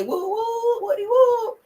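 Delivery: high drawn-out whooping call; playful cheer. Pretend. Host rallying her Patreon members, high playful whooping. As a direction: sing out a high, silly group cheer.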